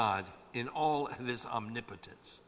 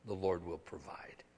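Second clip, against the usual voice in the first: hushed, breathy whisper after the cry; 6 semitones lower, 7 dB quieter than usual. Acted. Preacher voicing David's prayer, soft whisper. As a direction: drop to a whisper of trust.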